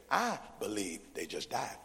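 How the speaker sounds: low, "I" held, then a deadpan drop; quiet punchline in chant rhythm; mock recitation. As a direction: echo the chant with stress on "I".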